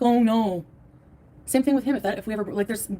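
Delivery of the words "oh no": sarcastic fake "oh no"; mock dismay. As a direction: pretend to be heartbroken, hammed-up. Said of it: "And be like".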